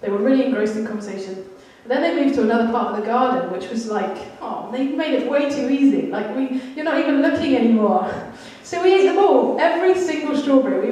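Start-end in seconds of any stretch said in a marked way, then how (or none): 4.7-10.9 high, loud gleeful telling; excited. Real, not acted. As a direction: relish the mischief. Gleeful retelling of kids' mischief.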